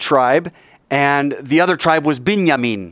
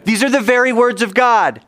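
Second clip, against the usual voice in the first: with gusto; 10 semitones higher than usual. Shown as a demonstration. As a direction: lead the congregation in a full-voiced prayer call. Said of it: "say it with some gusto".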